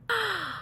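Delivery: a startled little intake of breath; sharp gasp. Real, not acted. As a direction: gasp in real surprise at the mistake. Makeup got on her lashes.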